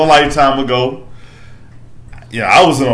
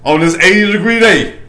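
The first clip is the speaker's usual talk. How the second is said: hype intro; 6 semitones higher, 5 dB louder than usual. Acted. Hype-man intros of podcast guests.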